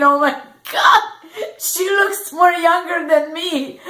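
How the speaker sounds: mock dismay. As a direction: play up the shock like you might cry. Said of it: "And I'm thinking".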